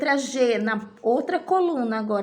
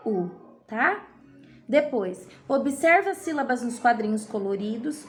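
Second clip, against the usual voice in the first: ordinary talk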